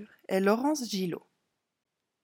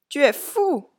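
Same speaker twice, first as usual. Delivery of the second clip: high, loud exclamation; exclaimed; 6 semitones higher, 8 dB louder than usual. A narrator's reading. Clown protests at the elephant cart, exclaiming loudly. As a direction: burst out at a crazy idea. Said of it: "s'exclama Antonio".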